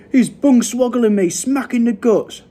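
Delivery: high, loud shout of complaint. A narrator's reading. Giant woken by a kick, shouting. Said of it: "shouted the gu".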